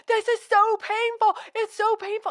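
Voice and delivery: high mock-whiny complaining; whiny husband. Wife mimics husband whining about the sensor pain. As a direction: imitate him moaning in fake agony. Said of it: "And he's like".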